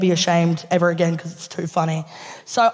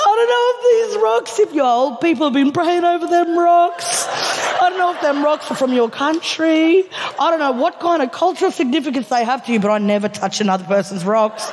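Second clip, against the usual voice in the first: lightly voiced sincere friend, raised pitch; earnest heartfelt; 7 semitones higher than usual. Friend earnestly explains to Steph about her rocks. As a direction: tell it with genuine earnest care. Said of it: "with the most genuine, serious, heartfelt, earnest response".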